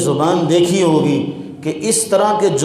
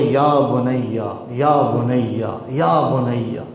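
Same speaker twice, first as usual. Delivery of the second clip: slow, soft and tender, lower; loving address; 7 semitones lower, 3 dB quieter, much slower than usual. Shown as a demonstration. Preacher voicing a loving father to his son, tenderly. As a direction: call the son gently, drawn out. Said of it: "کس قدر پیار سے سمجھا رہے ہیں".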